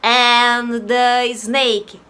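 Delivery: usual speaking voice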